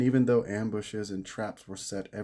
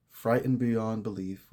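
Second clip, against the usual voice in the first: plain reading voice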